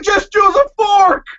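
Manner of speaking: high, slow exasperated yell; mock shout. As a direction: yell it at her in disbelief. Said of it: "I'm like".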